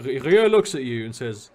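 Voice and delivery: ordinary delivery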